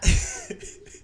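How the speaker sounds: loud, high-pitched burst of laughter. Real, not acted. Storyteller amused by his own bad situation, high bursting laugh. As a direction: crack up in a short squeal.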